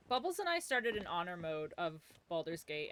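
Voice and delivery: ordinary talk, nothing marked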